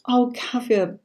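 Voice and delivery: ordinary talk, nothing marked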